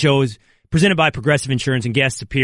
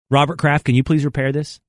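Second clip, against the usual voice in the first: ordinary delivery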